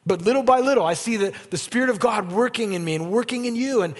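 high, loud, revved-up preaching; climbing to a near-shout over the hornets; fiery preaching. Acted. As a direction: preach it hard to the congregation. Preaching at full force again.

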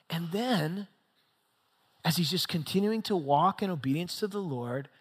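ordinary talk, nothing marked

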